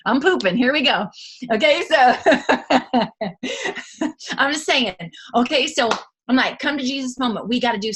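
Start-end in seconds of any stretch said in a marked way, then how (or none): 0.1-1.1 louder, higher playful resolve; gamely gearing up, half-laughing; mock resolve. Answering mama, she gears up in playful resolve. 1.5-5.0 high, loud laugh at her own story; cracking up at herself. Real, not acted. Laughing at her own poop joke, cracking up.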